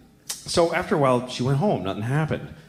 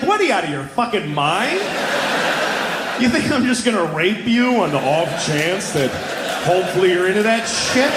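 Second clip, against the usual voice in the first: loud, high incredulous rant; hammed-up disbelieving outburst; mock outrage; 6 semitones higher, 3 dB louder than usual. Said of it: "I'm like".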